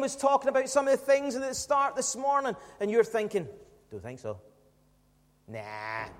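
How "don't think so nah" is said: very low, quiet brush-off; low, muted refusal of a doubter; dismissive doubt. Acted. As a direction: mutter it like a private, sceptical thought. Acted doubter's private dismissive thought.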